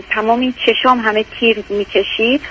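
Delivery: ordinary delivery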